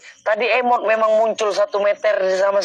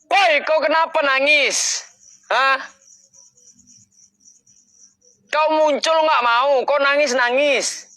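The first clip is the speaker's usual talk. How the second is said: higher, slowed call to the unseen; 7 semitones higher than usual. Real, not acted. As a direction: call out, half scolding the crying ghost. Calling out to crying ghost.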